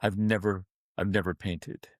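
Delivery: low, hushed confession; retold tearfulness. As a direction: confess it quietly, as if close to tears.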